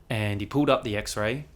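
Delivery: usual speaking voice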